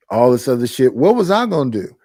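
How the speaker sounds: normal talking voice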